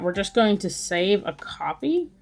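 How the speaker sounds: ordinary delivery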